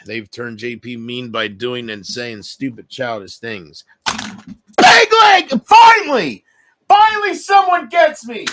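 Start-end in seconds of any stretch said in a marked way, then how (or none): 4.8-8.5 ecstatic high shout of relief; triumphant squeal of vindication; excited shout. Real, not acted. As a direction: yell it out, finally understood. Overjoyed outburst at a comment.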